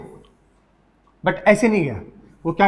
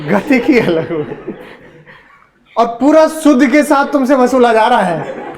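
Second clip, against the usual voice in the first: words spilling out through laughter; laughing; 4 semitones higher, 4 dB louder than usual. Real, not acted. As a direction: keep laughing while you tease the class. Teacher joking about students' struggle. (हँसते हुए बोलना)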